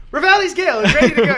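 high-pitched, fast mock announcement; confident game character. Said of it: "like, confident as hell".

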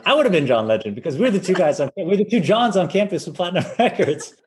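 laughing through the punchline; chuckling while joking. Real, not acted. Joke about changing his name.

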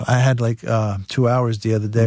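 ordinary talk

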